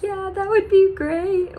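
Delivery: high-pitched imitation of answering through tears; retold teary squeak; mock tearful. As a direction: act out a weepy, grateful reply. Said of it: "With me crying".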